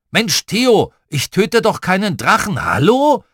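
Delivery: high, loud objection; indignant protest. A narrator's reading. Tom corrects his brother Theo, indignant. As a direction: protest as if it were obvious, voice jumping up.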